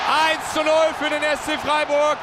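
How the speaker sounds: shouted goal commentary, high; goal call. Acted.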